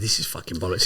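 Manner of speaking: low, hushed private thought; quiet thought. Retold or imitated. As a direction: say it under your breath. Inner thought, kept private.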